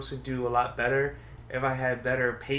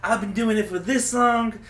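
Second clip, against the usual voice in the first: high, loud, drawn-out mocking brag; hammed-up self-important comic; exaggerated mock boasting; 8 semitones higher, 7 dB louder than usual. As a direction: stretch it out, mocking a show-off. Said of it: "I hate when they said".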